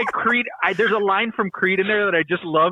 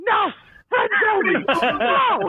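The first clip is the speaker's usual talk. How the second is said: high, horrified "not Toby"; Michael's dismay; slower than usual. Retold or imitated. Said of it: "he's like".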